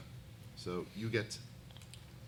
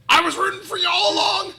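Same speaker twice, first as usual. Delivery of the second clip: very loud, high character yell; pink slip's voice; 13 dB louder than usual. Acted. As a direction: shout it shrilly from far down the pier. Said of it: "you hear a pink slip from".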